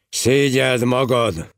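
slow, stern scolding; loud rebuke. A narrator's reading. Abbot shaming idle Heribald, loud rebuke. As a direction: scold him, drawing the words out. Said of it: "mondta hangosan Englibertus".

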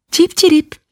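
very high, thin birdlike chirp; bird chirp. A narrator's reading. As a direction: chirp it like a small sad lark. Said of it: "bólintott igenlően a pacsirta". (magas, vékony madárcsipogás)

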